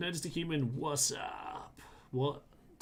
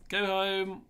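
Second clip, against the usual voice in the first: higher and louder, emphatic; louder repeat; 6 semitones higher, 3 dB louder than usual. Real, not acted. Sending his own troops home, louder and emphatic. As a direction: push it out the second time.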